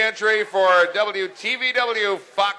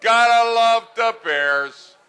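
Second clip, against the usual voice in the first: excited; 7 semitones higher, 4 dB louder, slower than usual. Real, not acted. Cheering the former Bear.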